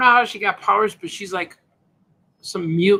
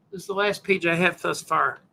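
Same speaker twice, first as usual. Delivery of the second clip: usual speaking voice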